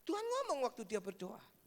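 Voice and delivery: ordinary delivery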